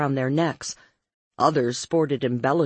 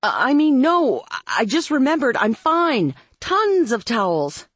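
flustered; 9 semitones higher, 4 dB louder than usual. A narrator's reading. Flustered backpedalling.

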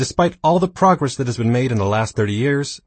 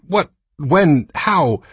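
louder, rising shocked questions; stammering in shock; 6 semitones higher, 5 dB louder, much slower than usual. Retold or imitated. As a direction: sputter each word in disbelief. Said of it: "She stammered".

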